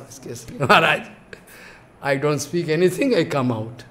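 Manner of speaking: soft, low apology; hushed, sheepish retelling in a low voice; meek retreat. Retold or imitated. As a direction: back out quietly after a scolding. Scolded disciple backs out.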